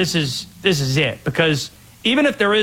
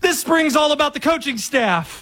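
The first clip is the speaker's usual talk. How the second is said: higher-pitched mock recital of a trained line; rehearsed coach answer; 8 semitones higher than usual. Imitating a coach falling back on football talk, mock scripted. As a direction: recite the talking point as a hurried cover-up. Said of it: "what was I trained to do".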